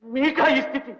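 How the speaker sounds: anguished disbelief. Acted. Doctor shocked at master's state.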